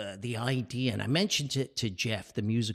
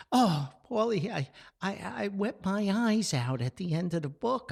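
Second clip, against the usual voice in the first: higher, emotional mother's voice, lightly done; imitating Mom; 10 semitones higher than usual. Son retells his mother weeping over his book, higher mock-tearful voice. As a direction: voice Mom gushing about the ending, pitched up.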